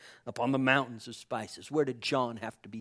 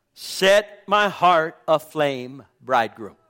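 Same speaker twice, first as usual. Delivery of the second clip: loud, very slow, word by word; impassioned plea; 7 dB louder, slower than usual. Acted. Bride calling to the bridegroom, slow impassioned plea. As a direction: weigh each word as a fervent plea.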